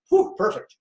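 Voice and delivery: high, gleeful relief; thrilled to get a clear order; delighted. Shown as a demonstration. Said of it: "that's how I am in the world".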